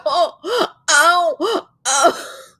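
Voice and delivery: high "ow" cracking into a laugh; pained laughing whimper. Retold or imitated. In pain, joking about her hurt shoulder, laughing whimper. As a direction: yelp through a giggle at the sore shoulder. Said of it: "I was like".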